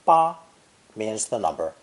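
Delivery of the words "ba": "ba" held high and level, no glide; high flat tone. Shown as a demonstration. Teacher showing learners the first Mandarin tone, high flat "ba". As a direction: say "ba" on a high, flat, loud pitch. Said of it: "spoken with a high flat tone".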